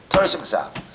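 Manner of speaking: usual speaking voice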